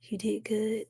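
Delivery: a soft whispered compliment; whispered praise. Real, not acted. Streamer quietly praising herself on the stream, whispered.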